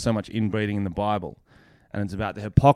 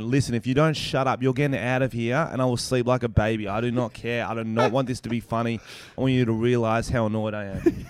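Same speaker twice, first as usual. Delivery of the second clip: normal talking voice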